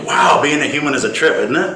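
laughing through the words; amused laughing wonder. Real, not acted. Laughing at being human.

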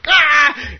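a hammed-up shriek of annoyance; frustrated yell. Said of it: "And you go".

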